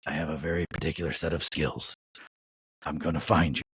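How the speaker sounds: low, menacing movie-threat voice; Liam Neeson. Retold or imitated. Complaining to a vendor, doing a low menacing Liam Neeson threat. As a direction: growl it like a calm action-hero threat. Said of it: "straight Liam Neeson".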